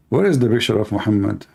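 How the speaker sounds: ordinary talk, nothing marked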